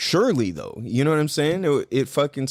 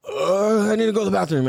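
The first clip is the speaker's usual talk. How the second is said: higher, hammed-up groan into an urgent excuse; retold panic; 5 semitones higher than usual. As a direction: mimic needing to escape right now. Acts out fleeing mid-transaction in panic.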